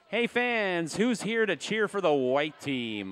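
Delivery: loud, slow crowd hype; calling to the crowd. Real, not acted. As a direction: call out to the whole arena. Hyping the crowd at halftime.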